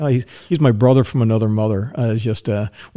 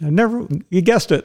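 usual speaking voice

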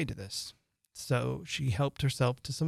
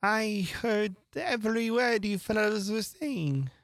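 hoarse feeble voice; 9 semitones higher than usual. A narrator's reading. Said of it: "in a hoarse, feeble voice".

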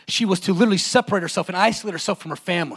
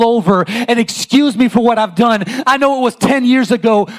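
higher, louder impassioned preaching rising to shouts; preaching at full force; 7 semitones higher, 6 dB louder than usual. Acted. Preacher voicing the woman's confession to the congregation, impassioned. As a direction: pour it out, building to a cry.

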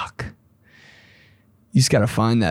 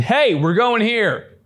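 higher, drawn-out commanding call; acted take-charge call; concise, decisive; 9 semitones higher, slower than usual. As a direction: announce the decision like the man in charge. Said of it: "you're still being concise and".

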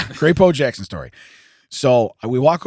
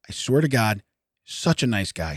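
ordinary talk, nothing marked

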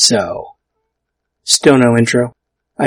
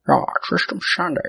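put-on lazy, soft Scottish accent; half-accidental, lazy Scottish film-star accent; Sean Connery; 6 semitones higher, 5 dB quieter than usual. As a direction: slur it in a loose Scottish accent. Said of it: "lazy Sean Connery".

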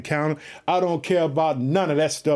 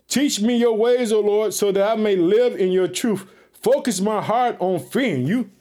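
fervent scripture reading; 5 semitones higher than usual. Acted. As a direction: proclaim the psalm to the congregation.

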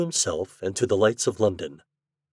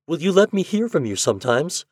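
pleading; 6 semitones higher than usual. Acted. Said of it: "she begged".

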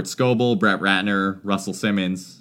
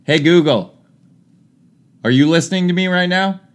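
loud, higher call to a smart speaker; calling to voice assistant; 9 semitones higher, 4 dB louder than usual. Real, not acted. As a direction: raise your voice to wake the device.

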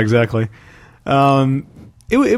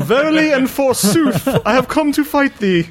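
hammy stage actor; 12 semitones higher, slower than usual. Pretend. Mock Shakespearean actor.

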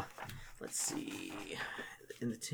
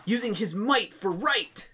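higher, slow proclamation; solemn motto; 7 semitones higher, slower than usual. Retold or imitated. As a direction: recite the motto proudly. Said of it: "acolyte quote continues".

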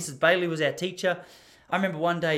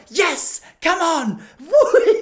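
high, loud whooping cheer; celebrating; 13 semitones higher, 11 dB louder, much slower than usual. Retold or imitated. Uncle celebrating his roulette win, loud whooping cheer. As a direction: yell out a win at the table. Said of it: "He started going".

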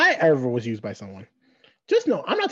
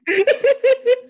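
high laugh; 6 dB louder than usual. Real, not acted. Laughing hard.

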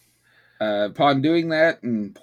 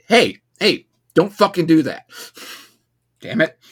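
mock stern dad; 6 semitones higher, 7 dB louder than usual. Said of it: "I was like".

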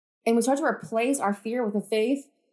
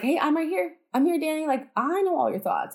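higher, eager voice for God answering; imagine God's reply, pitched up and insistent; lightly voiced God, higher at first; God reassuring her; 6 semitones higher than usual.

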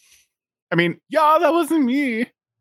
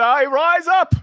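dramatic; 9 semitones higher, 3 dB louder, slower than usual. Acted. Player narrates rising dramatically.

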